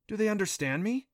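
high-pitched surprised question. A narrator's reading. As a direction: ask with wondering lift. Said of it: "she asked in surprise".

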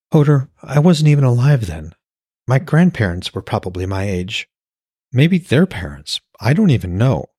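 lightly voiced woman. A narrator's reading. Woman telling Hoder about her short life, lightly voiced. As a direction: raise the voice slightly for her.

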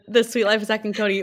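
ordinary delivery

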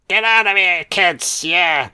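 lightly voiced stern doctor; Loomis impression. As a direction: bark it like the old doctor shooing kids away. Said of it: "she's gone full fucking Loomis".

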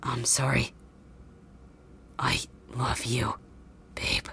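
soft, slow whispered farewell; last breath goodbye to his love; dying whisper. Acted. Dying boy's last words.

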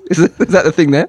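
usual speaking voice

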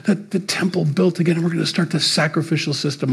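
fast, high, breathless eager rush; mock excited. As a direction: gush like an overeager fan. Mimics excited third-temple builders.

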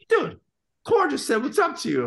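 high, loud, amazed; can't believe what just happened; excited. Retold or imitated. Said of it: "our monitor guy's like".

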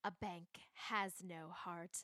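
low, slow villain voice; evil bank man. Retold or imitated. Podcast host quoting the evil bank manager, low and slow. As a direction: drop low and slow like a cold banker. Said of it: "And evil bank man goes".

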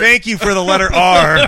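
laughing loudly through the thanks. Real, not acted. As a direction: blurt it out while cracking up. Laughing after the shirt slip.

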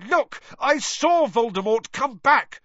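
high, loud urgent shout. A narrator's reading. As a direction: shout it desperately to be believed. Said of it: "Harry shouted".

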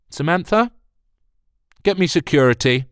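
sharp, loud order; stern command. Acted. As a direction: call security with icy authority. Executive curtly calls assistant.

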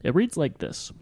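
ordinary talk

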